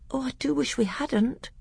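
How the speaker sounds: high, breathless whisper; young prince, whispered. A narrator's reading. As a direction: whisper it as the eager boy. Boy prince voiced.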